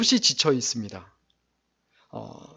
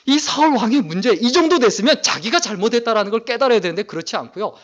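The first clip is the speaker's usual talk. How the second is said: higher, louder preaching on Saul's fault; forceful preaching; 6 semitones higher, 6 dB louder than usual. Acted. Preacher condemns Saul to the congregation, forceful. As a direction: press the point hard from the pulpit.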